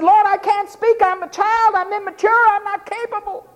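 put-on high, childlike pleading; Jeremiah's plea. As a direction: protest like a helpless child. Voicing Jeremiah.

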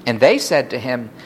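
ordinary delivery

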